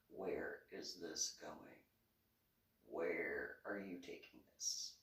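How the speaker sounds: soft, slow, baffled muttering to herself; a quiet, slowed-down where is this going; puzzled inner voice. Retold or imitated. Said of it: "I'm just looking at him like".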